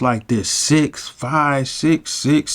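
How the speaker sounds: ordinary talk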